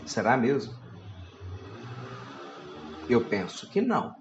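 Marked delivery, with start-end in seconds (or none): none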